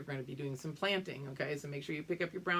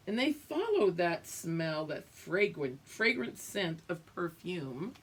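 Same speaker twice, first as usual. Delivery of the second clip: put-on reading to children. Reading picture book aloud.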